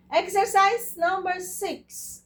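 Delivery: loud, slow announcement of the exercise title. Shown as a demonstration. Instructor to class: loud, slow title announcement. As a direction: call out the next exercise like a class leader.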